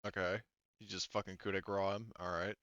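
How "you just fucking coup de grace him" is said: usual speaking voice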